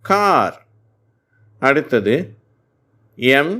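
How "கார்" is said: "கார்" said high with a long open aa; model pronunciation. Shown as a demonstration. Teacher closing the spelling with the word, clear demo. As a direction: land "கார்" firmly after spelling it. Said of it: "ஆ என்ற உச்சரிப்பும் கிடைக்கிறது".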